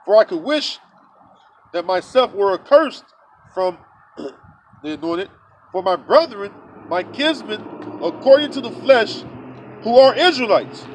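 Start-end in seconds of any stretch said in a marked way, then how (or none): none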